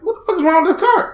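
high and fast, rushed disbelief. Retold or imitated. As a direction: fire it off quick and high, exasperated. Same reaction repeated in traffic.

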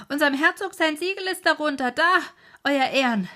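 loud, high, jubilant shouting; elated shout. Acted. Farmhand shouting to the village that peace has come, overjoyed and loud. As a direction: bellow the news of peace like a wild man. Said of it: "und rief".

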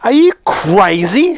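high, incredulous woman's shout; loud, drawn-out outrage. A narrator's reading. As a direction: shout it in disbelief, stretching the words. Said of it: "shouted the woman".

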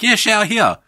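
high, breezy guide voice; mock tour guide. The tour guide pointing at the river, high and breezy. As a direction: offer it cheerfully.

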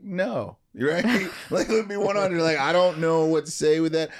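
high, loud retold protest; emphatic refusal. Speaker retells balking at giving the school speech, emphatic refusal. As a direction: protest loudly, pitched well up, with a laugh. Acts out his panicked refusal.

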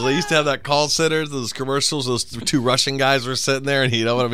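ordinary delivery

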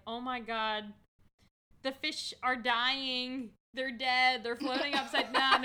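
loud, high, slow panic; mock panic. Retelling the group chat, mock panic. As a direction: wail the bad news.

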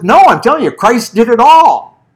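更响亮、放慢，坚决地强调"all"; 一字一顿，大声坚定地纠正; emphatic insistence. Retold or imitated. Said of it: "And it says".

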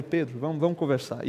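hushed invitation to talk alone; soft private call. Acted. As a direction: say it softly, just between two friends. Jesus takes Peter aside privately.

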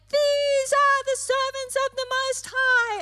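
high, loud, strange possessed cry; demon-possessed voice. Acted. Possessed person calling after the disciples, high loud eerie cry. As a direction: shriek it like the spirit is speaking through her. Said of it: "a demon-possessed person saying".

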